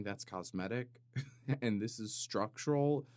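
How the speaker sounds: ordinary delivery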